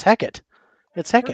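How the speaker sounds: Hecate in two short syllables, heck-ut. Shown as a demonstration. Accepting the looked-up way, Hecate said HECK-ut. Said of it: "is how to pronounce it".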